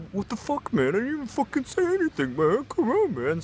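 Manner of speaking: very high, strained whine; panicked protest. Retold or imitated. Outmatched drunk roommate protesting to his attacker, panicked protest. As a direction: imitate an intimidated man pleading his innocence.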